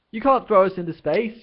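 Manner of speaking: high, loud, desperate yell. Acted. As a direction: protest at the top of your lungs. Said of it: "yelled Ford".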